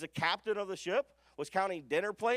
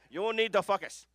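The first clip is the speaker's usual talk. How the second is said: light imitation of a heavy Jamaican accent, focus said like "fuckus"; 4 dB louder than usual. Jamaican coach urging the room to focus, imitated Jamaican accent. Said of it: "a heavy Jamaican accent when he says focus".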